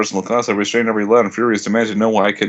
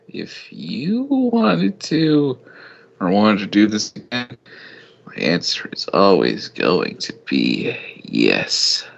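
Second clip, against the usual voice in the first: slow and sultry; slower than usual. Acted. Slowed, deepened romantic promise.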